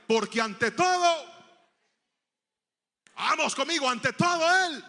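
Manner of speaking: preacher hammering "ante todo"; fervent emphasis. Real, not acted. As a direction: proclaim it with rising fire. Preacher building to a proud point.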